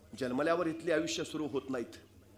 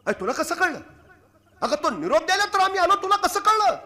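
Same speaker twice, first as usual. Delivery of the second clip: much higher, louder, astonished cry; startled king; 12 dB louder than usual. Acted. Startled king asking the mother how she knew, loud astonished cry. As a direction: burst out in shock. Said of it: "चमकले Raje".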